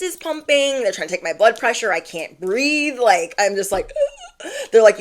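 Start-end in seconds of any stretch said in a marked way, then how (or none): 4.0-4.7 high, mock gasps for air; panicked gasping. Showing how she couldn't breathe.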